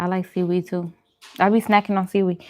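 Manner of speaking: normal talking voice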